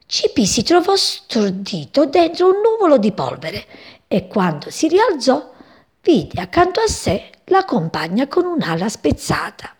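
ordinary delivery

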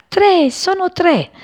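high, stretched-out joyful cry; happy cry. A narrator's reading. New father tells everyone of his three chicks, crying out happily. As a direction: proclaim it slowly and high, overjoyed. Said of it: "gridò la sua felicità".

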